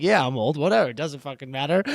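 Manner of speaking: put-on low, quiet, dismissive old guy; imitated shrug-off. Voicing the old guy brushing it off, imitated indifference. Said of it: "he was just like".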